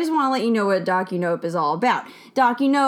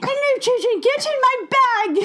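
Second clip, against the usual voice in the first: much higher, prim nanny voice; Mary Poppins voice; 13 semitones higher than usual. Acted. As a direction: coax the children into the bag, sweetly sinister. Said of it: "'cause Mary Poppins".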